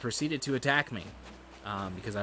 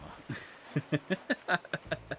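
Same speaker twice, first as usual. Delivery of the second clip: high, breathless staccato chuckle; laugh; 6 semitones higher than usual. Real, not acted. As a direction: laugh off your own trauma. Laughs at own trauma joke.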